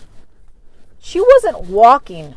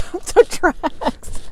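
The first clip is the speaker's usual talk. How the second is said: laughing; 9 semitones higher, much slower than usual. Real, not acted. Laughing recalling jump over tracks.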